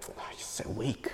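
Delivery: whispered mock contempt; a hushed sneer at weakness; scornful whisper. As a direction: breathe it out with disdain. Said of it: "And he'd be like".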